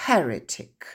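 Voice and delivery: heretic with the stress on HER, falling. Shown as a demonstration. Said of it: "les lettres rouges marquent l'accent tonique". (accent tonique sur HER dans heretic)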